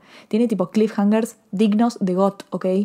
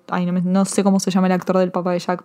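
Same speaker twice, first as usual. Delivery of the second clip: usual speaking voice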